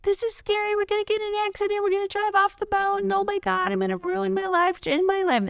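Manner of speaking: high, fast, frantic yapping voice; panicky inner dog. Retold or imitated. The barking dog Fifi panicking on hairpin turns, high frantic squeal. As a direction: squeal it out like a terrified little dog. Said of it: "My barking dog, Fifi, was freaking out, going".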